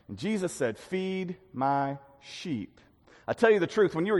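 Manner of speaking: measured Bible reading to the congregation; scripture reading. Acted. Preacher reading John to the congregation, solemn. Reads scripture passage aloud.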